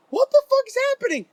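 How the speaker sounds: high, fast incredulous yelp; shocked outburst. Retold or imitated. Storyteller reliving his shock at the fireworks, high incredulous yelp.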